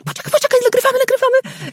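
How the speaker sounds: high-pitched, urgent imitation of a collaborator; Grzesiek urging. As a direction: mimic someone excitedly stopping everything to record. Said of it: "jak Grzesiek".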